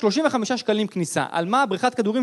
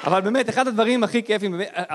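ordinary talk

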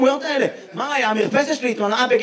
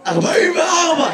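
hoarse, higher-pitched answer; real hoarse voice; 5 semitones higher than usual. Real, not acted. Audience member answering the comedian's age question, hoarse. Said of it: "למה אתה נשמע ככה".

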